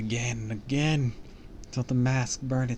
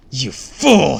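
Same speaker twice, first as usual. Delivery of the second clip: loud villain's scream, pitched up; furious scream; 4 semitones higher, 15 dB louder than usual. A narrator's reading. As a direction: scream the insult with full force. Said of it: "she screamed".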